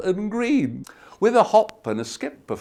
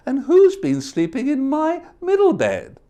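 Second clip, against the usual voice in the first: higher, lighter mother bear voice; Mama Bear; 11 semitones higher, 4 dB louder than usual. Acted. Mama Bear discovering her porridge, higher fussy. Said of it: "scratched her claw and said".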